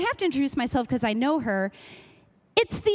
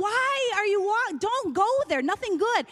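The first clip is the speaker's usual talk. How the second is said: alarmed warning; 9 semitones higher than usual. Acted. Audience warning her off going to the van, alarmed. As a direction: plead in a raised, stretched voice.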